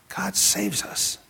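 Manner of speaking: slow hushed whisper; awed whispered truth. Acted. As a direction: breathe it, slowly and gently. Closing the Yeshua point.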